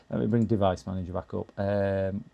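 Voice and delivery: ordinary talk, nothing marked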